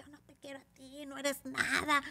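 high, mostly soft mock rejection; dismissive man. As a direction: imitate a client turning someone away. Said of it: "y el señor".

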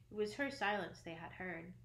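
plain reading voice

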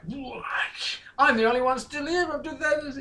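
mock reporters. Mocking reporters' reaction.

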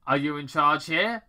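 Robotnik. Acted. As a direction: play the brash Robotnik. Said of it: "Doctor Robotnik asked".

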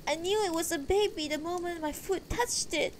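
high, mildly tearful wife's voice; close to tears. A narrator's reading. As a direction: insist in a high, upset voice. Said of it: "Beatrice sounded close to tears".

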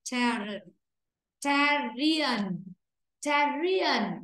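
Terrian, stress on the first syllable, clear fall; stressed syllable drilled. Shown as a demonstration. Teacher drilling the stressed syllable for the class. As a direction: isolate and drill the stressed part. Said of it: "Từ này chúng ta sẽ đọc là chữ tè".

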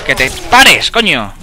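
loud furious yell at an enemy; angry shout. Real, not acted. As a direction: bark it at him to stop. Le grita a un enemigo. (grito furioso)

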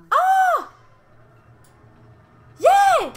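high, loud shout of delight; thrilled scream. Real, not acted. Player thrilled by the ride in the game, high loud cheering scream. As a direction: scream for joy at the top of the voice.

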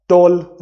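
टॉल in one unbroken beat; one clean syllable on टॉल; no pause inside. Shown as a demonstration. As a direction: say टॉल in a single go without stopping. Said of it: "नहीं रुके ना".

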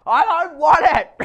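high, loud tearful shriek; shrieking child. A narrator's reading. Boy shrieking at his father in the surf, tearful and high. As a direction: wail it like a furious little boy. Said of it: "heard his son shrieking".

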